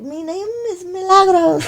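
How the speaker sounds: soft, slow Filipino auntie Milagros; Filipino accent. Retold or imitated. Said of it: "That's my Filipino accent".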